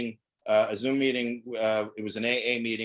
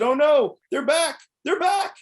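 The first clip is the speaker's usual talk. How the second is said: put-on much higher, louder, slow panicked kid voice; faked terror; 8 dB louder, slower than usual. Prank caller pretending terror as the parents return. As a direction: play a child hearing his captors return.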